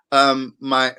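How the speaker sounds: usual speaking voice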